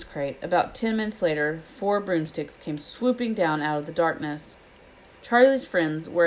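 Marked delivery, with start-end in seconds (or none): none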